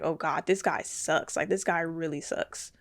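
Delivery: soft disgusted aside; muttering. Retold or imitated. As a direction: mutter it under your breath. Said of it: "And I'm like".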